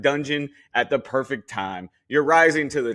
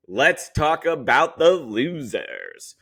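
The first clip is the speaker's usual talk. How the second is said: dramatic slow turn; 3 semitones higher, 3 dB louder, much slower than usual. Acted.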